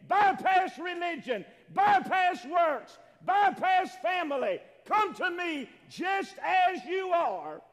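high, loud preacher calling the congregation in; full-force preaching. Acted. Preacher urging people to come to Jesus, full-force preaching. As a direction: preach it out loud and high, driving each phrase.

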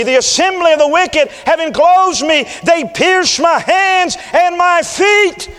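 high, loud, drawn-out shout on feet; preacher's shout. Acted. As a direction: cry it out at the peak of the psalm. Climax of crucifixion psalm reading.

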